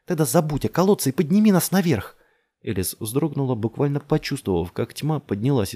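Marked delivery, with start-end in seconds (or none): 0.1-2.2 loud, high, forceful command; urgent order. A narrator's reading. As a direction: order him with desperate force. (громко, властно и отчаянно приказывает)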